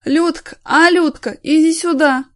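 put-on high loud drunken calling out; wailing call. Naked drunk man bawling for his wife, high and loud. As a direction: bawl for her from the sofa. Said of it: "завопил".

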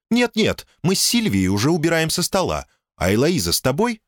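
cheerful woman answering the phone; friendly woman's voice. Acted.

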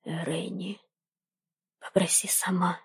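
low insistent whisper; urgent whisper. Acted. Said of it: "настойчиво прошептал Джордан".

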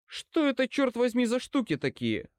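high, exasperated angry question; growled demand. A narrator's reading. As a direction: start high in outrage, then come down. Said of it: "прорычал я". (возмущённо и сердито)

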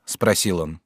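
usual speaking voice

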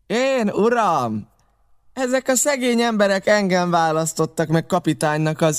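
Long John answering the captain; Long John's reply. A narrator's reading. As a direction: puff himself up as captain. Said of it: "Hosszú John maga felelt".

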